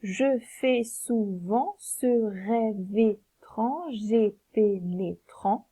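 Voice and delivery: very slow, syllable by syllable; slow scansion. Shown as a demonstration. Teacher scans the alexandrine for the class, very slowly. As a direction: stretch the line out to count it. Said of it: "on va faire la liaison".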